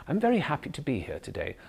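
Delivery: RP line that gently rises and lands softly on "today"; British soft landing. Shown as a demonstration. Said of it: "the British has a soft landing".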